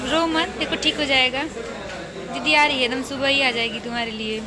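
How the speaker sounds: calm her tears, promise help is near. Real, not acted. Consoling the crying girl again.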